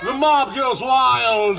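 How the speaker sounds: loud, slow shouted cue; announcer cue. Acted. Showman cueing the audience, slow loud shout. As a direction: whip the crowd into cheering.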